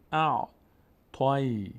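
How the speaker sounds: "اع، تای" as separate intoned syllables; syllable drill. Shown as a demonstration. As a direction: join each letter to the next in "اع، تای". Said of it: "تا میچسبه به یا".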